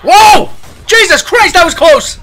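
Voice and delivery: high frantic shouts after a near miss; screaming for help after a close call; panicked screaming. Real, not acted. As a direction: yell in shaky terror. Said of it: "From all that screaming".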